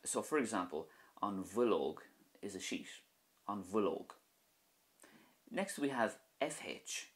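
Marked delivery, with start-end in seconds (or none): 1.2-2.0 an bhuilóg with bh said as a V, wil-ogue. Shown as a demonstration. Teacher showing learners bhuilóg with bh as V. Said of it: "this is a V sound in Irish". 3.5-4.1 an bhuilóg with bh said as a V, wil-ogue. Shown as a demonstration. Teacher showing learners bhuilóg with bh as V. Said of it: "this is a V sound in Irish".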